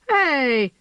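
loud high indignant cry. A narrator's reading. Starling scolds the cats on her roof, indignant. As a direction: snap at the intruders. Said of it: "she said indignantly".

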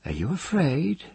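high, lilting taunt; soft mockery. A narrator's reading. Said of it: "Ged answered him softly, mockingly".

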